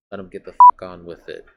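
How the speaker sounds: sudden loud burst of bitter defiance; despairing outburst. Acted. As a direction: spit it out loud in hopeless rage.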